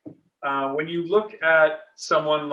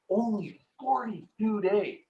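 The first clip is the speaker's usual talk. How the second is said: "Only forty-two days" slow, every word stretched; extended words; 6 semitones higher, 3 dB quieter, slower than usual. Shown as a demonstration. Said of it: "everything's emphasized".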